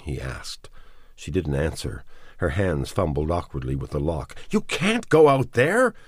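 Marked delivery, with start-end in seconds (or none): 4.5-5.9 sudden high, loud startled cry; startled man shouting to stop her; surprised. A narrator's reading. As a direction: blurt it out in shock, much higher. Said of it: "he said, surprised".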